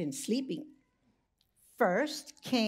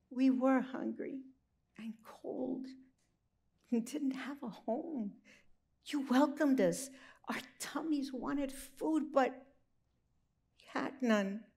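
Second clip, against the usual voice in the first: quiet and sad; 3 semitones higher, 5 dB quieter than usual. Acted. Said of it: "mooed Mama Cow".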